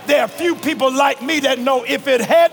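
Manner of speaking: preaching, building. Real, not acted. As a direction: build to a long, shouted cry.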